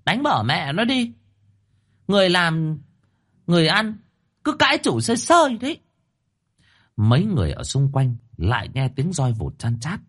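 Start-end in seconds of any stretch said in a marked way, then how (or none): 0.0-5.8 much higher, scornful mistress voice calling out; wife urging husband to beat servant, high and scornful; spiteful second wife. Acted. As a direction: egg on the beating from the porch, contemptuously. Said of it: "bước ra ngoài thềm nói với".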